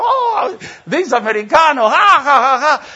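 high, hearty mock laughter; high-pitched laughing as the father. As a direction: laugh it out like the amused host. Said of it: "he laughed".

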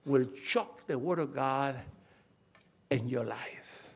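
low, subdued pulpit repetition; hushed solemn warning. Real, not acted. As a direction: drop low and soft after the build. Solemn warning after the loud build.

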